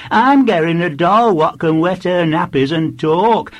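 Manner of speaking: put-on high, loud little girl's voice; boasting small girl. A narrator's reading. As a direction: blurt it out like a child competing for attention. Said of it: "added a large girl".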